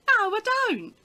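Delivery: very high, loud mock insistence; emphatic refusal. Mum contradicting the window salesman, acted loud and insistent. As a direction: refuse point-blank, acting out a stubborn customer.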